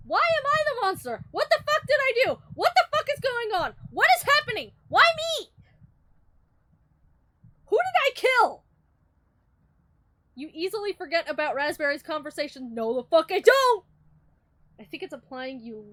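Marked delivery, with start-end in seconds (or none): 0.1-8.6 much higher, louder frantic protest; outraged yelling. Real, not acted. Player called a monster, yelling high and outraged. 12.7-13.8 loud denial. Real, not acted. Rejecting narration.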